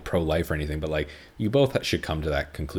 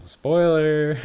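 spoiler warning; 10 semitones higher, 7 dB louder than usual. Real, not acted. Announcing spoiler to listeners.

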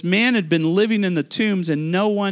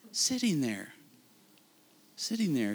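much softer, dwelling on "Sitting there"; soft repeated echo; 8 dB quieter than usual. Acted. Preacher lets the healed man's stillness sink in, much softer. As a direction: let it sink in quietly.

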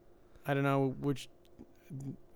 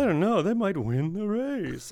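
high, louder camp cartoon voice; Snagglepuss voice; 6 semitones higher, 3 dB louder, slower than usual. Friend still in the cartoon lion voice. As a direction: keep the cartoon lion going, slow. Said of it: "Why would I talk like this?".

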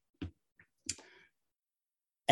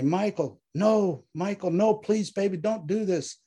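higher-pitched begging, short of a full scream; wife pleading desperately with husband on the phone; desperate screamed plea; 7 semitones higher than usual. A narrator's reading. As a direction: beg him not to go. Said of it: "she screams".

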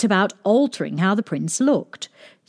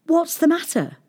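put-on much higher, inquiring girl; young girl's voice; 10 semitones higher than usual. A narrator's reading. Mucky girl offering the prince help, high girlish voice.

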